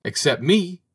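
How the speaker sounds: high-pitched piping protest, only mildly screamed. A narrator's reading. Little bird without a name interrupting the birds, high mild scream. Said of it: "screamed the little fellow".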